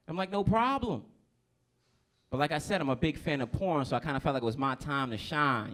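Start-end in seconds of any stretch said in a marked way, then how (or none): 0.3-1.0 high, agreeable reply; eager agreement. Acted. Man agreeing to lady friend's rule, high eager voice. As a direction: agree readily, pitched up.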